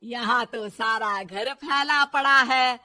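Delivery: loud, slow, drawn-out scolding; angry mother-in-law. Acted. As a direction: stretch each complaint, then order her off loudly. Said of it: "बहू की इस बात पर गुस्सा आ जाता है".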